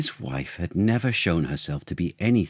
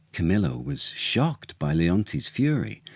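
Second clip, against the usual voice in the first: ordinary delivery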